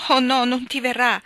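a sister crying out against bad news; loud exclamation. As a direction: deny it out loud in fear. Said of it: "esclamò Joe".

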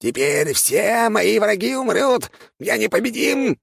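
high, slow triumphant squeak; gloating rat king. Acted. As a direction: crow it like an unbeatable monster.

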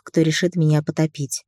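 ordinary delivery, nothing marked

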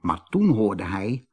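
ordinary delivery

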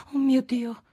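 high dismayed exclamation; breaking a parasol, a sudden high cry; exclaiming. A narrator's reading. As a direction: gasp it out in dismay. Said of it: "esclamò Federico".

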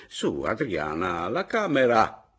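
urging call. Acted. Father urging daughter to show the room, calling out. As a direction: prod her along, voice climbing to a shout.

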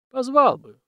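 husband tossing his wife's words back; emphatic echo. Acted. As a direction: echo her, half reluctant.

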